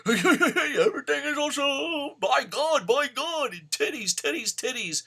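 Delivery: over-the-top fake commentator excitement, laughing through it; mocking a commentator's forced hype, very high and loud; fake enthusiasm. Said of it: "that fake enthusiasm".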